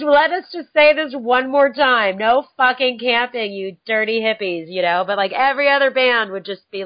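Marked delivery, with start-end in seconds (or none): 0.0-4.7 louder, slower, higher mock announcement; hammed-up warning to the hippies. Band ad scolding campers, louder mock announcement. Mocking the band's ad.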